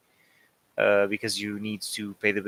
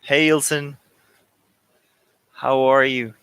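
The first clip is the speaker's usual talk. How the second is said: cheerful greeting; 3 semitones higher, 8 dB louder than usual. Real, not acted. Greeting a viewer on stream.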